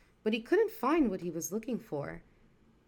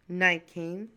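ordinary delivery

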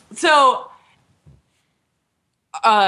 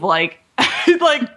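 usual speaking voice

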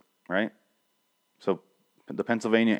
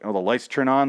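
normal talking voice

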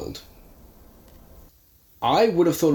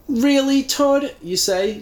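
mocking; 5 dB louder, slower than usual. Mocking Todd's wailing.

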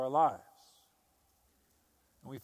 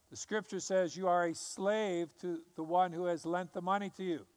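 ordinary talk

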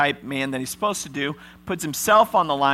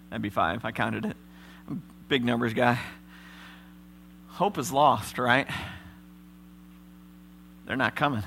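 Simeon alone; 2 semitones lower than usual. Acted. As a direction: mutter like a man left to rot. Preacher speaks as jailed Simeon.

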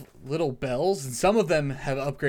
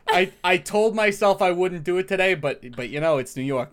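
Doctor Joey voice; 5 semitones higher, 7 dB louder than usual. Acted. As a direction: announce yourself like a brash regular. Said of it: "Doctor Joey".

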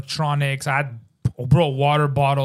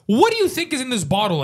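higher pitched mock challenge; retold heated question; 9 semitones higher than usual. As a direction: throw it at the guard, incredulous. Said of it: "I was telling the guy".

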